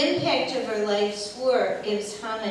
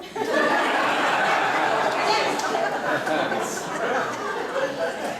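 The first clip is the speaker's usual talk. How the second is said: long, louder breathy laughter; loud airy laugh; 4 semitones higher, 8 dB louder than usual. Real, not acted. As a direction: burst out laughing at the memory. Laughs after the story.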